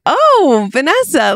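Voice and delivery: high, drawn-out surprised "oh"; lightly voiced surprised relative; surprised greeting. Aunt and uncle surprised greeting.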